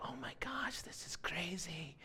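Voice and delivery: hushed, slowed wonder at the lights; amazed whisper. Retold or imitated. Traveler whispering in awe at the airport floor lights. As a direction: breathe it out in disbelief. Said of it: "And I'm like".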